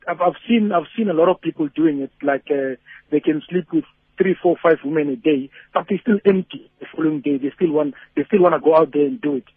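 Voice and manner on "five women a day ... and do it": normal talking voice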